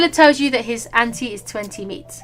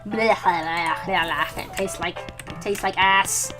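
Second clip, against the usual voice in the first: disgusted; 5 semitones lower, 4 dB quieter, much slower than usual. Real, not acted. Eating slop, disgusted slow voice.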